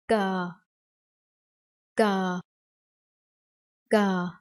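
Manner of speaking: GA said alone, pitch sinking slightly; consonant demo. Shown as a demonstration. Teacher introduces the second consonant, GA modelled in isolation. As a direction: model GA clearly for repeating. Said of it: "And the second one is".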